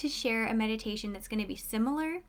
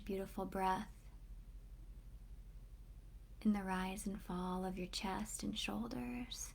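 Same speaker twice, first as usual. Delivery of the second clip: hushed, unhurried guide, settling listeners inward; guided meditation; 8 dB quieter than usual. Real, not acted. Host guiding online listeners to close their eyes and breathe, guided meditation.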